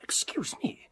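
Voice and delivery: a sudden hushed whisper. Acted. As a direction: drop to a whisper before asking. Foma breaks off.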